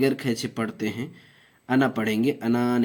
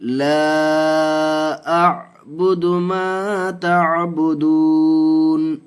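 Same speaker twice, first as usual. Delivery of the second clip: slow, high, drawn-out chanted recitation; Quranic recitation; 5 semitones higher, 6 dB louder, much slower than usual. Shown as a demonstration. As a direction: intone each syllable long and melodic like tajweed recitation. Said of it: "इसको तीन अलिफ खींच के पढ़ेंगे".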